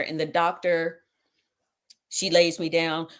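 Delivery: normal talking voice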